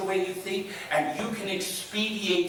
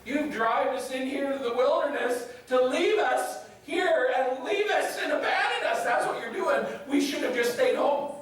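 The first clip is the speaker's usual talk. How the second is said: higher-pitched mock outrage of a kid; accusing child; 6 semitones higher than usual. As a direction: accuse dad like an aggrieved child. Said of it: "And the kids are like".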